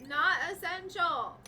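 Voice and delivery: irritated. Real, not acted. Gamer scolding characters about pointless tasks. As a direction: bite it out in annoyance. Angry repetition.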